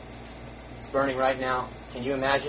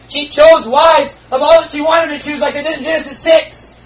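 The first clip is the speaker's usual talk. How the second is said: preaching shout; 13 semitones higher, 12 dB louder than usual. Real, not acted. Preacher erupts about Eve.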